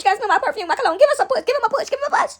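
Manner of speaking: high, excited wheedling; Satan. Retold or imitated. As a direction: egg God on in a loud, high rush. Playing Satan pestering God.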